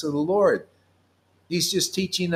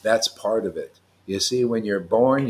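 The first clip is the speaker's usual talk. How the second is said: normal talking voice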